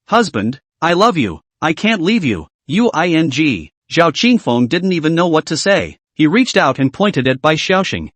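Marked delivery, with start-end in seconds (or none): none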